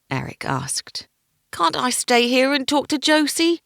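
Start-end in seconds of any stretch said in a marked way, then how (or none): 1.5-3.6 high, loud pleading whine; whining. Acted. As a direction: whine like a sulky boy. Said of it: "Quentin whined".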